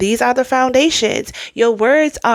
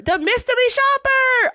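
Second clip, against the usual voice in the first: excited announcement; 13 semitones higher than usual. Real, not acted.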